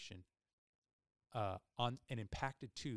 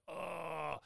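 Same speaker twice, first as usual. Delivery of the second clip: long "ugh", pitched up; pained groan; 5 semitones higher than usual. Retold or imitated. Patient retelling how much her face hurt, pained groan. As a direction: groan as if your whole face aches. Acting out whole-face pain.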